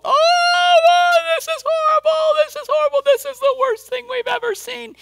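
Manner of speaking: very high, loud squealing panic; hysterical disciples. Retold or imitated. Pastor mocking panicked disciples on the boat, shrill falsetto. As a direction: ham up frantic disciples in falsetto.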